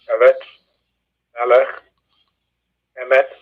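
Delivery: "eretz, melech, emet" each stressed on its first syllable; E-retz, ME-lech, E-met, with pauses between. Shown as a demonstration. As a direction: list "eretz, melech, emet" one by one, accent up front. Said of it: "they are accented on the first syllable".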